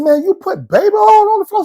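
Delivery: high, loud incredulous question; can't believe what he's hearing; disbelief. Retold or imitated. Confronting the cellmate over the baby oil, high disbelief.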